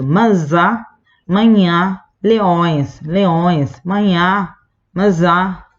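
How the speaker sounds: "Manzá, mañá, leones" as if stuffed up with a cold; very nasal vowels. Shown as a demonstration. Teacher demonstrating the tilde to students, very nasal "Manzá, mañá, leones". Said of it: "bien nasal el sonido".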